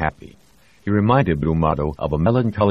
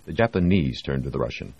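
ordinary delivery

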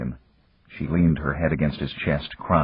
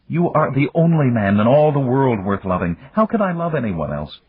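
high, louder impassioned declaration; woman in love; 7 semitones higher, 4 dB louder than usual. Acted. Woman confesses her love to the man, impassioned.

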